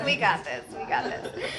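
mock macho "We got this" through laughter; playful brave boast, laughing; imitating Kate. Pastor teasing Kate in the back, mock tough boast. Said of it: "Kate's back there going".

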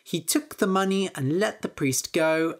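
ordinary delivery

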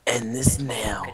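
whispering. Acted. Dramatic line during game.

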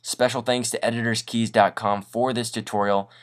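normal talking voice

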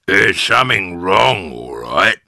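loud, relishing character voice; gloating husband; Mr Twit enjoying himself. A narrator's reading. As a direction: lean into it, enjoying her worry. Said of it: "beginning to enjoy himself".

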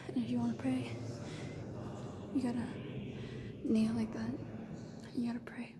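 hushed temple whisper. Real, not acted. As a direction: whisper so it won't echo. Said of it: "I gotta talk really quiet".

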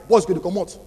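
ordinary talk

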